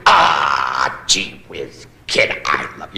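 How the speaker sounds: put-on low, gruff old-timer voice; Wallace Beery impression. Roast comic imitating Wallace Beery talking to a child star, low and gruff. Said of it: "Wallace Beery said".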